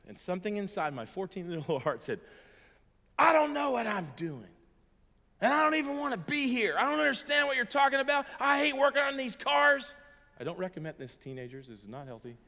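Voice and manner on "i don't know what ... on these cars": put-on loud, high, defiant teen yelling at dad; teenage outburst. Son shouting back at his father in the garage, loud and defiant. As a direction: blurt it out like a hurt fourteen-year-old.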